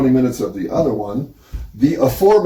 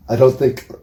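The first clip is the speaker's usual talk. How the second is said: normal talking voice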